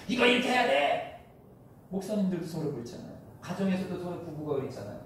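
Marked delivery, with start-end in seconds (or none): 0.1-1.2 high, loud, bossy shout; mock angry insistence. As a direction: bark it like a stubborn church fighter. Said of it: "싸우고".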